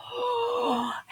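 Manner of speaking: high startled gasp; sharp gasp. Acted. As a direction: gasp in shock. Flower droops, shock.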